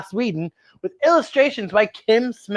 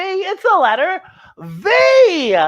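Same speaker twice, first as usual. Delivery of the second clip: slow, higher, stretched out V; slow letter reveal; 10 semitones higher, 4 dB louder, much slower than usual. Shown as a demonstration. Host revealing the letter to the kids, slow and emphatic. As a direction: draw the letter V out slowly. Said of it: "No, it's not the letter B".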